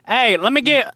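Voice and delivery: loud, high mock ordering voice; mock restaurant customer. Customer ordering in a restaurant, loud imitation. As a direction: call out an order like a clueless customer. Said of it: "go into a restaurant and be like".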